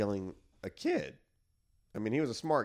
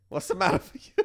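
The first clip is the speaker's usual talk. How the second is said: mock Italian accent; 9 semitones higher, 5 dB louder than usual. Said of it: "Godfather quote".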